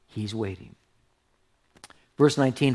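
ordinary delivery

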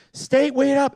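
imitated yelling. Wife calling to those ahead, mild imitated yell. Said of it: "She's yelling".

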